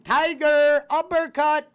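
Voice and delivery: high, loud fighting-game call; announcer shout. Pretend.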